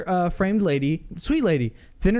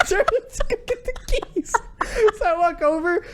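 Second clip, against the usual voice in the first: mock obedience breaking into high laughter; laughing retelling. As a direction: crack up while acting the obedient helper. Cracks up retelling his obedience.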